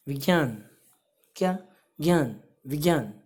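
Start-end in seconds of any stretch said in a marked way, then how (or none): none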